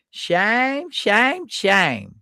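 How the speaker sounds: sing-song scolding like Gomer Pyle. Retold or imitated. Quoting Gomer Pyle scolding a shady company. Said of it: "As Gomer Pyle would say".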